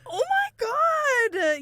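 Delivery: high, loud, drawn-out gasping shriek; shocked laughing cry. Real, not acted. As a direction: let the shock burst out laughing.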